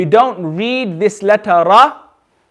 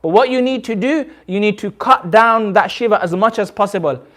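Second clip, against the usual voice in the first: usual speaking voice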